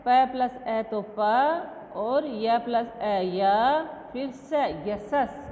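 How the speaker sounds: slow, letter by letter; teacher slowly joining letters; slow spelling out. Shown as a demonstration. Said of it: "spelling out letter joins".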